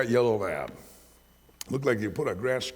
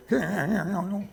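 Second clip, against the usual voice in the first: cat meow; 8 semitones higher than usual. Retold or imitated. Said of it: "I hear him go".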